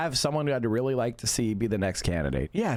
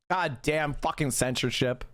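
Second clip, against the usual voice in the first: ordinary talk, nothing marked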